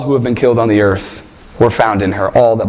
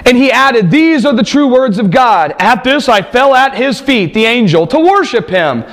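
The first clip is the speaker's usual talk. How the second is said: raised, ringing reading of the vision; scripture reading; 10 semitones higher than usual. Acted. Preacher reads Revelation aloud to the congregation, ringing and raised. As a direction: proclaim the text to the congregation.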